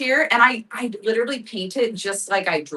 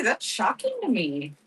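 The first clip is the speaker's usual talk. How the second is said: ordinary talk, nothing marked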